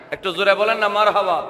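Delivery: normal talking voice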